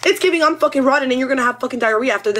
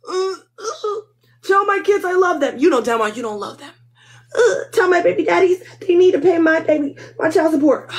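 high, crying last words; dying sob; 6 semitones higher than usual. Acted. Hot mama gives dying wishes, hammed-up crying. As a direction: sob out a dramatic farewell.

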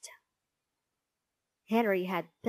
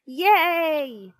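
loud, high, drawn-out yay; shrieking cheer; 7 semitones higher, 10 dB louder than usual. A narrator's reading. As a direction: cheer like an excited class. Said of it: "shrieked the class".

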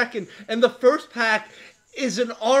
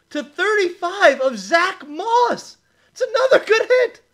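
high, laughing excitement; thrilled; 7 semitones higher than usual. Real, not acted. Breaker reveals Zach Moss auto, thrilled and laughing. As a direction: announce the hit through delighted laughter. Second big hit, laughing.

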